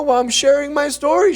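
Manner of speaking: high, loud protesting son; hammed-up comeback in an argument with mom; mock exasperation. Acted quarrel with his mother.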